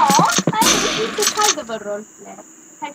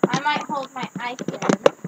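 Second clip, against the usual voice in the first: ordinary talk, nothing marked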